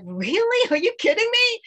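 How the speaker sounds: retold excited gasp of disbelief, rising high. Retelling her thrilled reply to the baker, excited. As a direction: squeal it as if you can't believe your ears. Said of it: "In my excitement".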